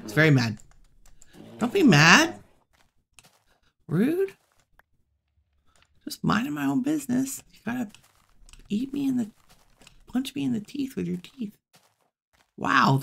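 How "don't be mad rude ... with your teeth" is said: much higher, mock-offended whine; high mock-offended complaint; mock indignant. Real, not acted. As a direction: whine high like you're wronged. Complaining to the angry bear.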